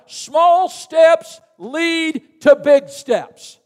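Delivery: slow and loud, each word weighted; slow rising sermon line; slow preached build. Real, not acted. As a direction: build the church up to a shout.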